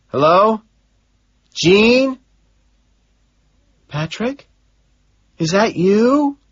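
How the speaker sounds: a secretary calling back, unsure who it is; Gene calling back. Acted. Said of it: "she calls back".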